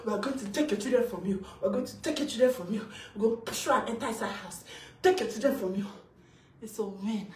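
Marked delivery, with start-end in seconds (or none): 0.1-5.9 rapid-fire recital of threats; quoted threats. Retold or imitated. Mother mimicking those who threatened to take children, rapid-fire. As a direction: rattle off their threats, fast and repetitive. Retelling threats made to her.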